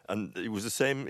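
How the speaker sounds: normal talking voice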